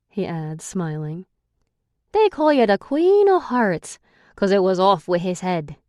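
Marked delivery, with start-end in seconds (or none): 2.1-5.8 raised, scornful Irish male voice; Declan, Irish brogue. A narrator's reading.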